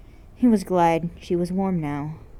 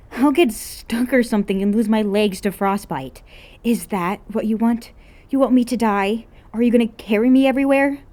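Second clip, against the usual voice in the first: higher, louder, rising into a fast rant; hammed-up outrage, speeding up; dramatic whining girl; 5 semitones higher, 6 dB louder than usual. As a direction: melodramatic teen complaining about the cold. An's dramatic freezing complaint.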